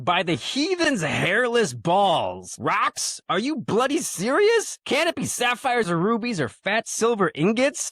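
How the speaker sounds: high, slow, brash bandit-woman voice, no drawl; [name]'s reading. Acted. Podcaster reading the bandit leader's lines to friends, high and brash. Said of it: "she does not say these lines with a Southern drawl".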